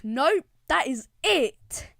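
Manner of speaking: loud, slow, emphatic refusal; mock really mad. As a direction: act out being fed up, dragging each word. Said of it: "So I get really mad".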